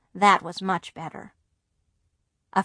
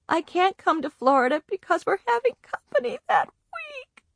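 much higher, squeaky, near tears; 10 semitones higher than usual. A narrator's reading. Girl tells Grandma on the phone, voice high and squeaky. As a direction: voice squeezed by crying. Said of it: "my voice sounded very high and squeaky".

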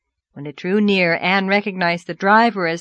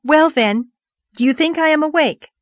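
high, loud, breathless-with-news question; excited questioning; 8 semitones higher, 6 dB louder than usual. Acted. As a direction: press her with wide-eyed excitement. Excited girl teases Marilla.

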